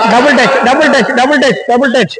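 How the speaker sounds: high, repeated call-out; excited foul call. Real, not acted. Commentator calling a double touch to players, excited call-out.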